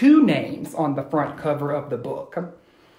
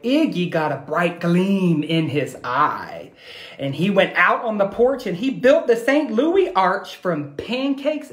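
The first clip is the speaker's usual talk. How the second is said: put-on lively read-aloud to children; animated picture-book reading; storybook narration; 4 semitones higher than usual. A narrator's reading. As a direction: tell it like a bedtime story.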